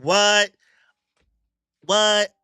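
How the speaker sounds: high, loud disbelieving shout; shocked what. Acted. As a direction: blurt it out in shock. Shocked character reacting to survivors.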